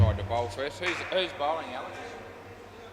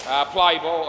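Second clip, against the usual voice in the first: loud, higher call to start play; 4 semitones higher, 9 dB louder, slower than usual. Real, not acted. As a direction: call it out across the court. Umpire-style call to start play.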